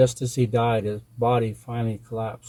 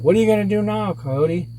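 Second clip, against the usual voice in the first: high, raised appeal to Coyote; anxious people; 9 semitones higher, 4 dB louder than usual. A narrator's reading. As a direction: ask it as worried villagers calling out.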